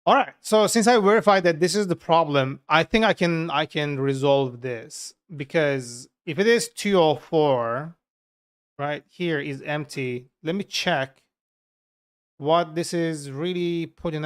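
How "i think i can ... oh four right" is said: ordinary talk, nothing marked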